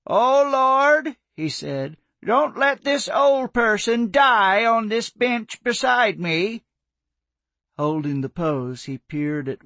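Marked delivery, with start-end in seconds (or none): 0.1-6.6 loud, slow hammed-up plea to heaven; mock prayer. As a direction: overplay a prayer to tease her. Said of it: "Jones raised his arms and looked upward".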